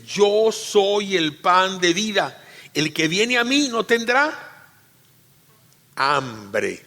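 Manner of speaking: slow, high, drawn-out reading of the verse; solemn scripture reading. Shown as a demonstration. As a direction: proclaim each word slowly for the congregation to follow. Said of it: "Lean conmigo, lean conmigo". (lectura solemne, lenta y aguda)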